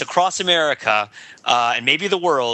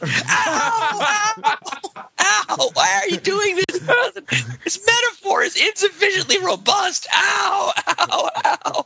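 much higher, laughing yelps; yelping in pain; much slower than usual. Acted. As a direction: squeal like a hurt cartoon victim. Mock victim yelping in pain.

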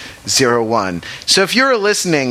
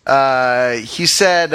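normal talking voice

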